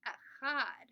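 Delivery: put-on teacher modeling "Echad" for children to echo; Hebrew number. Shown as a demonstration. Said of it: "We'll say it in Hebrew".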